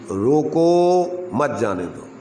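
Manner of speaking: long drawn-out रोको, a break, then मत जाने दो; stress on रोको. Shown as a demonstration. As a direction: hold रोको, pause, let मत जाने दो fall. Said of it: "वजन कहाँ देना है". (रोको को खींचकर रुकें, फिर मत जाने दो)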